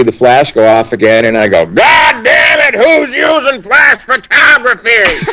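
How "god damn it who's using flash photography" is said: high, slow barked officer shout; Dale Dye impersonation. Retold or imitated. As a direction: roar at the recruits like a colonel. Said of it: "first Dale Dye impersonation".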